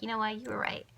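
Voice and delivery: a small, humbled apology; sheepish apology. Retold or imitated. Apologizing to the pendulum, quiet and sheepish. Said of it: "when you have to apologize".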